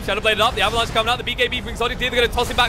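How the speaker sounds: high, rapid esports commentary; live play-by-play. Real, not acted. Caster calling a team fight live, excited play-by-play. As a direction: call the fight with rising urgency.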